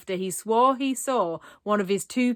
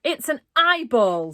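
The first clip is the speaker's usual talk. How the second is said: higher, louder panicked cry; screamed; 5 semitones higher, 7 dB louder than usual. A narrator's reading. Raj shouting at the eyeball in panic. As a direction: shout it out in horror. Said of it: "screamed Raj".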